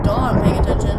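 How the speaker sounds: loud insistence; insistent. Real, not acted.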